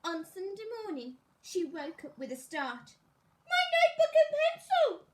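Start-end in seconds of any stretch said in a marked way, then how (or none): 3.5-5.1 high, loud cry of alarm; cried out. Acted. Miss Tidy waking with a start, crying out in alarm. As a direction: shout it out, suddenly remembering. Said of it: "she cried".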